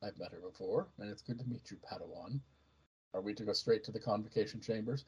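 low, soft, measured reply; reserved Jedi. Acted. Reserved Jedi greeting an eager young Padawan, low and soft.

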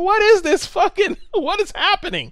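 very high, stunned exclamation; shocked disbelief. Retold or imitated. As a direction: squeal in horrified confusion with a laugh in it. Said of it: "I was like".

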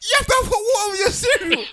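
high, loud laughing insistence; boy lecturing teachers about cereal, high and loud; insistent. Retold or imitated. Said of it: "I said".

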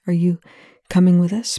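plain reading voice

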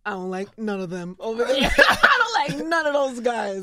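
retold grumble breaking into laughs; chuckling imitation of a sulky newcomer; laughing mock grumble. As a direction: laugh through the complaint, slowing the end. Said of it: "and I was like".

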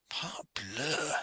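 hushed, breathless French oath; whispered oath. Acted. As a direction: let it escape under the breath. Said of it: "he cried".

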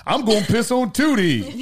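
slow, high, mock-grand preacher cadence; mock dream speech. Friend mocking the bed-wetter as a dreaming orator. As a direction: ham it up like a grand oration.

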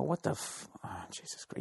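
whispered dismay. Real, not acted. Dismayed at the definition.

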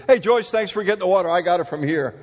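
a colonel breezily taking over; breezy colonel. Retold or imitated.

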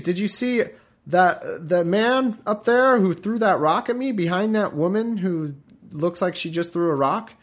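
mock-dying man loudly insisting on his story; loud dying declaration. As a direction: announce it loudly, as if dying for an audience. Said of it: "I would have just said real loudly".

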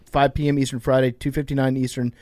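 brisk sponsor read to listeners. Acted. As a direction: sell the promo clearly. Host reads sponsor ad.

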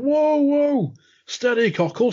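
high, drawn-out whoa; imitated high-pitched warning; mock alarm. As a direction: pretend to steady someone about to spill. Acted warning to shaky waitress.

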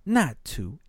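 ordinary talk, nothing marked

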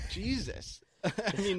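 high airy giggle fading out; high breathy laugh. Real, not acted. Laughing at his own story, high and airy. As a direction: wheeze a laugh then sigh.

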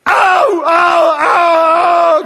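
high, loud mock pain yelps; pained cries. Girl runs across the stage, loud mock pain yells. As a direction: yell ow as if every step hurts. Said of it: "So she went".

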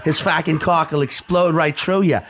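put-on thick Boston accent, laughing through it; mock Boston guy warning a friend; a playful hammed-up Boston accent. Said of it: "that's me doing a Boston accent".

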